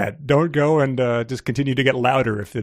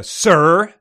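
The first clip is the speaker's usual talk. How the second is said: loud, higher overpronounced address; overpronouncing; 7 semitones higher, 8 dB louder than usual. A narrator's reading. Reader voicing an overpronouncing tourist. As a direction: spell each sound out as if to the deaf. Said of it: "overpronouncing his words as if he was speaking to a deaf person".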